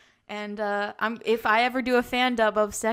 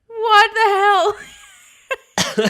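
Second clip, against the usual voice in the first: mock surprise; 11 semitones higher, 12 dB louder than usual. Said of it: "I was like".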